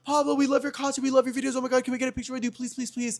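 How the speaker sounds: gushing diva fans. Retold or imitated. Two fans begging Pablo for a picture, gushing. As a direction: imitate two starstruck divas begging, high-pitched. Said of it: "these two divas. They're like".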